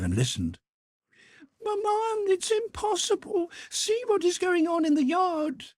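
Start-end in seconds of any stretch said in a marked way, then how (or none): 1.6-5.7 put-on high girl's voice crying out; pleading cry. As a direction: urge her mother, outraged. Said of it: "she cried".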